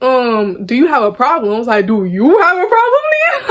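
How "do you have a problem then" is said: too passionate. Retold or imitated. Firing the girl's question back at her, high and heated. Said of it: "I was getting a little too passionate".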